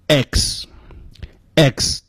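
"X" as a short "eks" with a hissed ending; short-vowel X. Shown as a demonstration. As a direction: say "X" crisply, short e then ks. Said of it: "前面这个I要注意发成是".

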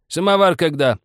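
high, loud impatient question; shouted demand. A narrator's reading. Old man demands the samovar from his wife, shouting. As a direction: call through to the kitchen. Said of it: "крикнул он, отдышавшись".

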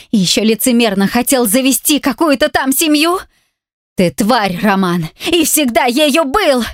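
a wife finally fighting back at her abuser; defiant outburst. A narrator's reading. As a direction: hurl every accusation at him.

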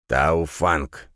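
ordinary delivery

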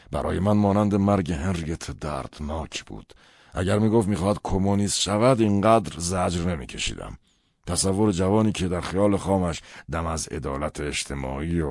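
put-on forceful, emphatic older man's voice; aggrieved father.

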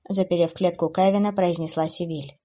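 ordinary delivery, nothing marked

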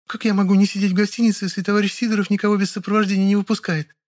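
high female character voice; high-pitched protest; Lyolya, protesting interruption. Acted.

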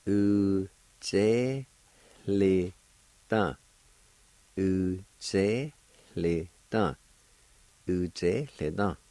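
Uzeleta said slowly and deliberately, each syllable clear; slow careful pronunciation. Shown as a demonstration. Teacher models the word for extra, slow and careful.

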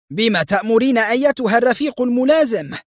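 high, agitated question to a superior; flustered. A narrator's reading. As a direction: ask for orders in a fluster. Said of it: "وهي تقول في اضطراب".

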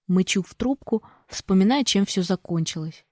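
ordinary talk

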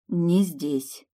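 mildly hoarse voice; husky. A narrator's reading. Heroine steers prince into her rooms, hoarsely. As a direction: let it come out a little husky. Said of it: "неожиданно сипло вымолвила я".

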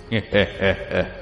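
a soft demonic cackle; evil laugh. Acted.

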